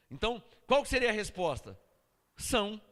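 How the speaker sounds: ordinary delivery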